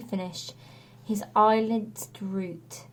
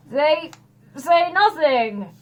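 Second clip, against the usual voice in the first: unconvincing; 6 semitones higher, 5 dB louder, slower than usual. A narrator's reading. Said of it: "didn't sound very convincing".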